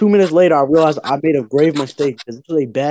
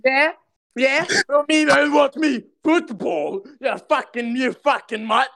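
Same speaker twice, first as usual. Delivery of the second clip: put-on over-the-top British accent, much higher, shouting; British impression; 8 semitones higher than usual. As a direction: screech a cartoon British lad's rant. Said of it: "impression of a British person".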